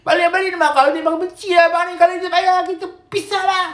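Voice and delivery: hammed-up crying complaint, very high and sustained; rengekan menangis. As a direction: wail at the husband in mock tears. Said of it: "jangan nangis-nangis". (meraung merengek tinggi, lakonan isteri beremosi)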